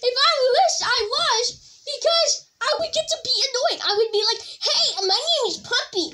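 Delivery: high, giggly kitten voice with meows; playful kitty contestant. Pretend. Kid playing a kitty contestant in the pretend show, high giggly kitten voice. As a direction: mew and whine like a sulky kitten.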